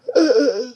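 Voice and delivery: loud open-mouthed yawn. Real, not acted. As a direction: stretch into a big yawn. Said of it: "so excuse the yawning".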